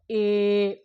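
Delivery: "ఏ" held long; long vowel. Shown as a demonstration. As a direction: draw "ఏ" out slowly as a long vowel. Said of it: "దీర్ఘాలు తీస్తాం అనమాట". ("ఏ" దీర్ఘంగా సాగదీసి)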